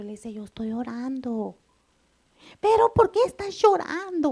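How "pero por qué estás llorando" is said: put-on much higher, louder child asking why mom cries; child's worried question. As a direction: voice a little boy asking, alarmed. Child asks mother in story. (niño preguntando preocupado, voz aguda y fuerte)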